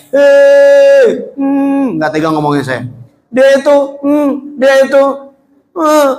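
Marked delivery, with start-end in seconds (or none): 0.1-2.0 high, loud heckler's "eh"; yelling. Acted. Blind man heckling in the market, yelling. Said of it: "teriak-teriak". 3.3-6.1 high-pitched heckling, broken off mid-phrase; blind heckler. Acted. Blind man badmouthing the Prophet in the market, heckling. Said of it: "buruk sama orang Yahudi yang buta di pasar, teriak-teriak".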